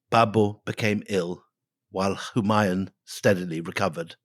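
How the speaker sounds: normal talking voice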